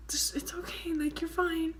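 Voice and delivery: soft whisper, calming her down; whispered comfort. Retold or imitated. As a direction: hush them gently, it's okay. Friends soothing her, whispered.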